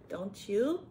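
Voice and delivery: usual speaking voice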